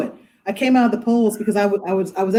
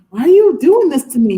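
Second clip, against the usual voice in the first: mock distress; 8 semitones higher, 5 dB louder than usual. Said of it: "I was like".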